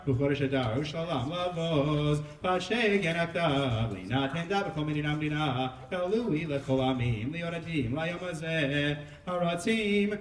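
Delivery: steady liturgical chanting of scripture; a cantor reading the scroll; Megillah cantillation. Acted. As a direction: chant the Hebrew verses in the traditional trope.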